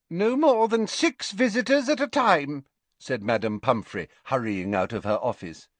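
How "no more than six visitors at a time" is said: high-pitched officious scolding; strict matron. Acted. Madame Pumphrey hurries out to visitors, high and officious. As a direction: pipe up sharply with the rule.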